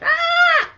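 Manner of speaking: piercing high mock bird shriek; imitated bird screech. Imitating the bird's desperate screech to passersby. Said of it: "It was like".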